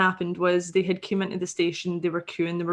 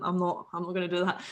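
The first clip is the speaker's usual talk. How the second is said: ordinary talk, nothing marked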